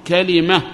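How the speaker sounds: كلمة said ka-li-ma with a kasra on the lam; kalima form. Shown as a demonstration. Teacher giving students the best-known form, careful demo. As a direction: give كلمة its full three syllables, ka-li-ma. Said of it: "فتح الكاف مع كسر الوسط".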